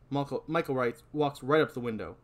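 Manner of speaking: ordinary talk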